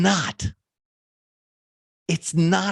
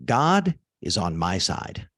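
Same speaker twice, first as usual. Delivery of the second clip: slow dictation; 10 semitones lower than usual. Shown as a demonstration. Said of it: "Just write down".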